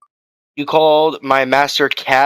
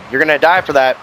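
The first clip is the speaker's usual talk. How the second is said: usual speaking voice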